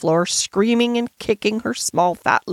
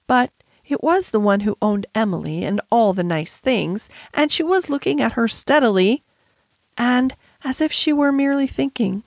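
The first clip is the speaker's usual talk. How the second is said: ordinary delivery, nothing marked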